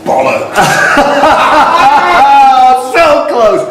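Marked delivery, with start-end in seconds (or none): 0.6-2.9 high laugh. Real, not acted. Host laughs at near miss.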